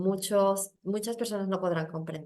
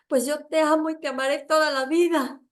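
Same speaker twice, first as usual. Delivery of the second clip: higher, firm declaration; insistent love; 7 semitones higher, 5 dB louder than usual. Retold or imitated. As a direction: answer her hate with love. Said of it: "yo le contestaba". (declaración firme y aguda)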